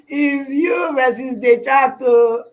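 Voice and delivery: mock crying. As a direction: fake a whiny sob for laughs. Mock lament about trading alone.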